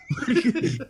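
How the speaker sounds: real amused laugh breaking out; high laugh. Real, not acted. Laughs at fans' reaction.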